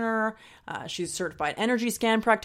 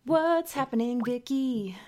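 singsong calling; 5 semitones higher, slower than usual. Pretend. Calling friend on Skype.

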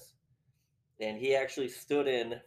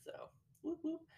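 whispered whoop; 10 dB quieter than usual. Retold or imitated. Mimics the stabbing.

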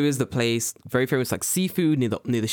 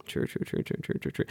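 whispered rapid agreement; 7 dB quieter than usual. Real, not acted. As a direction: breathe the words out, almost silent. Muttered agreement after a joke.

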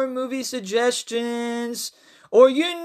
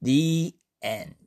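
story narration; 4 semitones lower than usual. A narrator's reading. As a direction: tell the tale unhurried and low. Reads his horror story aloud.

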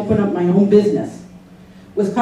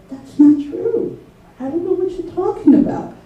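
protesting denial; 9 semitones higher than usual. Retold or imitated. Employee denying supervisor's accusations, protesting. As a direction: push back in a raised, disbelieving pitch.

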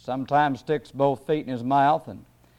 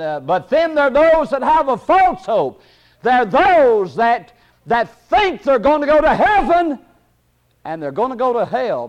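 high, ringing pulpit delivery; high-pitched, fervent preaching; forceful preaching; 6 semitones higher, 3 dB louder than usual. Real, not acted. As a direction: drive the warning home to the congregation.

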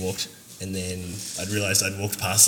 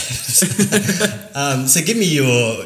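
laughing; 6 semitones higher, 9 dB louder, much slower than usual. Real, not acted. Laughing after accent talk.

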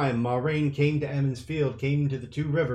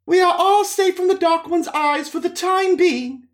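much higher, louder commanding woman; Moiraine announcing; 6 dB louder than usual. Acted. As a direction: announce it to the room. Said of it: "Moiraine announced".

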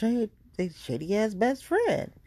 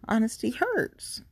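ordinary talk, nothing marked

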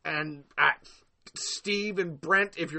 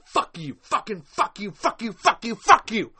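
rapid-fire curses building louder; real anger, spat out over and over; pissed-off cursing; 3 semitones higher, 6 dB louder than usual. Real, not acted. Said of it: "Oh, I am so pissed".

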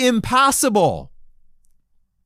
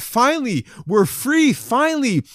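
excited; 6 semitones higher than usual. Acted. Preacher voices freed Israelites leaving Egypt, excited. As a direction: celebrate freedom in a higher, elated voice. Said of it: "They're excited".

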